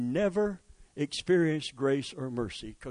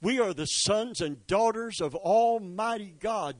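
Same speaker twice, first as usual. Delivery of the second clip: ordinary delivery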